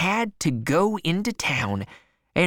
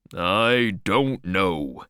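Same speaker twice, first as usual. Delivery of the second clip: put-on much lower, slow old husband; worried old man; 9 semitones lower, slower than usual. A narrator's reading. Old man fretting about the missing boy, low and slow. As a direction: let the worry weigh it down.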